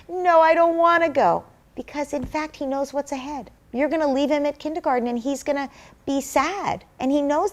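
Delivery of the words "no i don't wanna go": put-on higher child's voice refusing to go; whiny toddler. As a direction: play the toddler balking at kindergarten. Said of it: "Let's say he still says".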